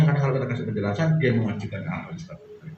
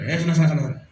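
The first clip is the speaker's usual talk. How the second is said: usiran keras dan ketus, lebih keras; mengusir dengan kesal; 5 semitones higher, 6 dB louder, much faster than usual. Retold or imitated. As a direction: usir anak dengan bentakan. Parent shooing child away. (orang tua kesal mengusir anak yang merengek)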